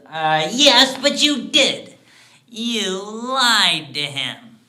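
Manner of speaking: loud, high, drawn-out needling; gleeful accusation. Acted. Dwarf catches the man denying his lie, accusing loudly. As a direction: pounce on the denial, stretching the final charge.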